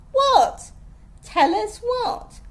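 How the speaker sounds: high, loud challenge; challenging demand. A narrator's reading. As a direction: demand proof, slowing down on it. Friend challenges her claim.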